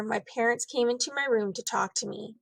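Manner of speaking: ordinary delivery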